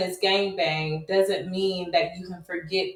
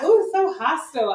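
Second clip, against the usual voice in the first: mock offended; 8 semitones higher than usual. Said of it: "I just like".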